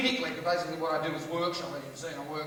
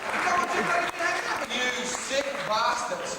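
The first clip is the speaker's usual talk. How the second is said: mock outrage; 6 semitones higher, slower than usual. Comic mock outrage at audience.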